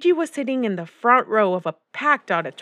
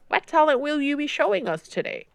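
higher-pitched, encouraging question; drama teacher; 6 semitones higher than usual. A narrator's reading. Drama teacher to a girl on stage, encouraging. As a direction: ask it like a teacher hosting auditions.

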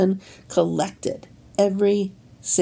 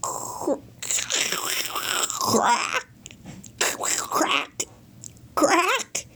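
1 whispered egg cracking; 10 semitones higher, 5 dB quieter, much slower than usual. A narrator's reading.

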